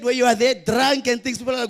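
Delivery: ordinary talk, nothing marked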